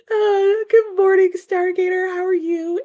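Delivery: high, loud greeting breaking into real laughter; laughing greeting. Real, not acted. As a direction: greet through a fit of giggles you can't hold back. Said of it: "I can't even read these comments correctly".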